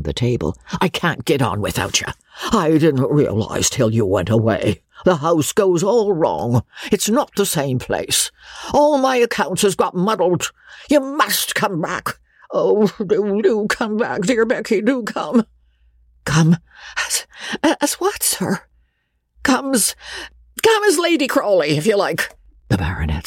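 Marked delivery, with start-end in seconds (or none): 0.8-15.4 plea climbing from level to high-pitched; increasingly urgent character voice; pleading old baronet. A narrator's reading. Baronet pleads with Rebecca to return. 16.3-18.6 high, halting question; taken-aback young woman's voice; startled Rebecca. A narrator's reading. Rebecca startled by the baronet's plea. 19.4-22.4 put-on high-pitched, urgent old baronet; excited character voice, restarting the phrase; blurted proposal. A narrator's reading.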